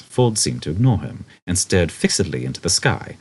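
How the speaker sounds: ordinary delivery, nothing marked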